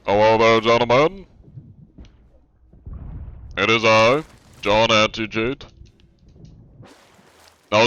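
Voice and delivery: loud, projected official in character; jokey, loud inspector voice; mock anti-cheat officer. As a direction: call out to suspects with mock courtesy. Greets players as John Anti-Cheat.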